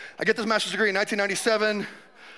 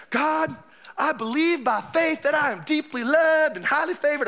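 mock Southern revival declaration, raised pitch; Southern accent; 3 semitones higher than usual. Preacher mimicking a believer's prayer, put-on Southern accent. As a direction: imitate a fervent Southern believer. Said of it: "I'm using Southern accent".

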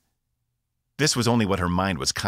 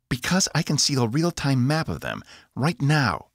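light, higher giddy voice; 9 semitones higher than usual. A narrator's reading. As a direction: sound almost giddy. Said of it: "Her voice was light, practically giddy".

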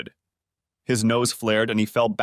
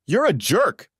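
much higher angry yell; 9 semitones higher, 4 dB louder, slower than usual. A narrator's reading. Wife yells at the lover who just insulted her, high angry yell. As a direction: hurl it at him. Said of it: "Dana yelled".

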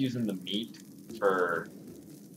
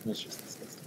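disgusted whisper; 13 dB quieter than usual. Real, not acted. Disgusted aside, unvoiced.

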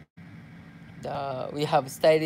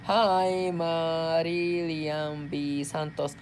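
normal talking voice

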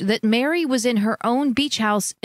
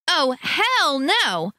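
higher, very slow, scornful; flat refusal with scorn; retort; 7 semitones higher, much slower than usual. A narrator's reading. Teresa refusing Jake, scornful retort. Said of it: "Teresa retorted".

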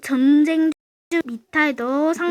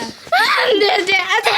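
shouting; 6 semitones higher than usual. Real, not acted. Losing in game, yelling.

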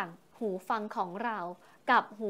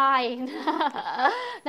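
laugh; 2 semitones higher, 4 dB louder than usual. Real, not acted. Laughs after her own joke.

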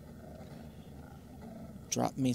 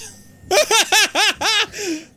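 shrieking laugh; 10 dB louder than usual. Real, not acted. Laughing after scream.

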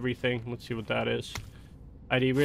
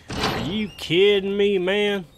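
slower and higher, stretching kidding; drawn-out disbelief; 7 semitones higher, 4 dB louder, slower than usual. Real, not acted. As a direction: lean hard into kidding, incredulous. Repeated with more disbelief.

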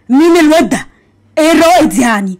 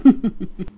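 soft laugh; 2 semitones lower, 6 dB quieter than usual. Acted. Laughs after teasing.

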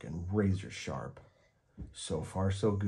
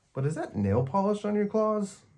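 put-on much higher, louder disbelieving kid; surprised child; 13 semitones higher, 5 dB louder than usual. A narrator's reading. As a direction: ask as if you can't believe it.

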